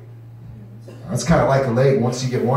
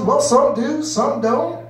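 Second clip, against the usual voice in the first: mock solemn bro; 10 semitones higher, much slower than usual. Guy answers.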